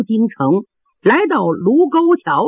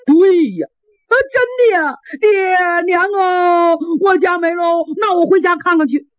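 sudden high wail, drawing out 爹娘啊 as he cries; a big simple boy bursting into tears; wailing simple lad; 7 semitones higher than usual. Acted. Lad realises his family is doomed and wails aloud. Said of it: "这傻小子放声大哭".

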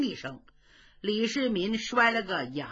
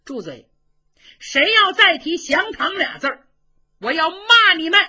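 furious; 7 semitones higher, 9 dB louder than usual. Acted. Shan refuses surrender furiously.

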